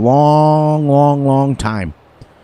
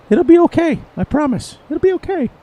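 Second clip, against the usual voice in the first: imitating Big A; 14 semitones higher than usual. Streamer quoting friend Big A's catchphrase, high reassuring imitation. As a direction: coo it like the friend's famous line. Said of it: "in the famous words of Big A".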